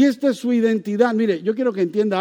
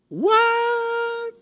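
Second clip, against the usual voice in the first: much higher, hammed-up what; mock surprise. Preacher acting out the shock of the news, hammed-up surprise. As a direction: leap up in pitch with put-on astonishment.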